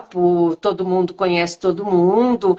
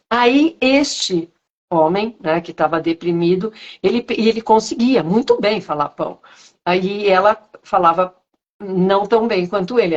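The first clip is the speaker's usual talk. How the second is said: ordinary talk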